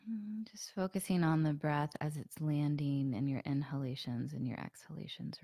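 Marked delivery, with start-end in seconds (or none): none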